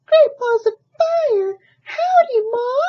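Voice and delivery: put-on very high, loud baby goose voice. Baby goose greets cat as ma, very high and loud. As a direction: holler a cheerful howdy. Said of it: "looked up at Jack and said".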